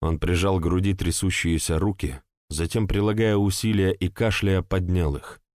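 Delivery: ordinary delivery, nothing marked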